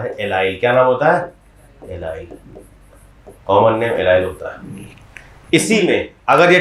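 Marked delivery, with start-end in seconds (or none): none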